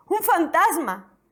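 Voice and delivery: high, slow cry of fear; a startled, fearful shout; frightened cry. A narrator's reading. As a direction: disciples crying out in terror at a ghost. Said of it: "y gritaron de miedo".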